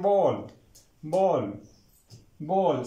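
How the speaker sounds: "बोल" with a long o, falling; correct vowel. Shown as a demonstration. Teacher models the kitchen word for students, long o. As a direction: say "बोल" as in Hindi bolna. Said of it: "जैसे बोलना होता है ना".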